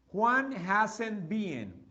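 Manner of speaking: Juan hasn't been, each word stretched out; very slow model phrase. Shown as a demonstration. Teacher slowly modelling Juan hasn't been for pupils. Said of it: "¿Cuál será la pronunciación?".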